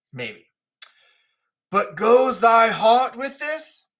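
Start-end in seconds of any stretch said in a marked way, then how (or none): 1.7-3.9 Lear's pained question. Acted. Lear questioning Cordelia.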